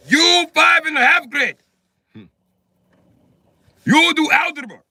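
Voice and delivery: high, loud, stern Russian parent voice; strict Russian parent. Acted. Parent scolding a child about algebra, high and loud.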